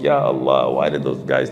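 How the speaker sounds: low, groggy-style exasperated complaint; retold exasperation. Woken by crying in the night, retold exasperated grumble. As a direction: imitate a weary, low-pitched grumble on being woken.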